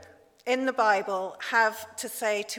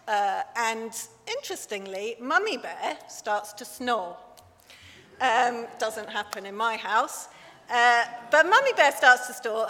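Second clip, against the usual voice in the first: normal talking voice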